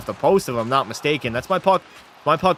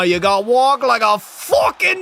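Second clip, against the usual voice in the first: high, loud, stretched-out goal call; goal celebration yell; 8 semitones higher, 4 dB louder, much slower than usual. Real, not acted. Streamer yelling at chat over his goal, high and drawn out. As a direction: scream the goal like a play-by-play man.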